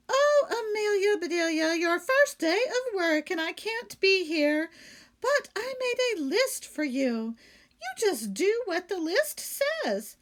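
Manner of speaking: high, loud, fussing employer voice; hurried high instructions before leaving; Missus Rogers voiced. A narrator's reading. Employer leaving instructions to her new maid, high and loud. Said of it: "said Missus Rogers".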